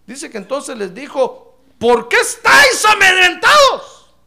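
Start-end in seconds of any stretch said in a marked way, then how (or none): 1.8-4.1 slow, much higher and much louder rebuke; rebuking shout. Retold or imitated. Jesus scolding disciples of little faith, a slow loud shout. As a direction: thunder the rebuke at the frightened men.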